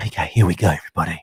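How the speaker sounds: deep, soft sultry host voice; sexy voice. Acted. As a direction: drop low and purr it. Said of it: "you sexy voice button".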